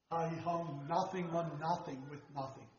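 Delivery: put-on a soft, measured retelling of the old preacher's phrasing, not his full voice; imitating Rocklin. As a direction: lay the three nothings down quietly, like the preacher who first said it. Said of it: "the way he said it".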